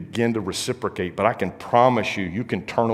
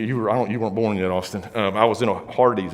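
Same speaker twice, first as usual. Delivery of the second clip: ordinary talk, nothing marked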